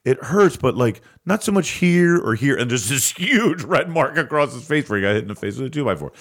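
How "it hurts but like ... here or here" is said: higher, louder mock Farley voice; hammed-up dazed tough guy; Chris Farley impression. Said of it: "Chris Farley is like".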